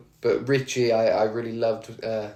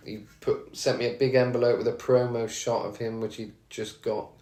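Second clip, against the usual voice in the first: normal talking voice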